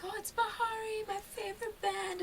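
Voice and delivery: high, slow, gushing local fan voice; excited fan. Retold or imitated. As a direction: play an overjoyed Kenyan fan spotting the band. Local fans greeting the band.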